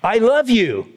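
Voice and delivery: sharp, high-pitched angry declaration; irritated, pitch jumping up high. Shown as a demonstration. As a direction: say loving words as if angry. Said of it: "if you're angry at somebody and you go".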